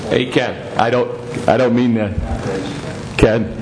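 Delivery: ordinary talk, nothing marked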